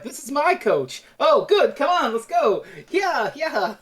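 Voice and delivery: put-on gleeful pushy old author; delighted Dickens. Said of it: "Dickens is like".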